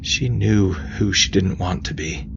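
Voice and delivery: ordinary delivery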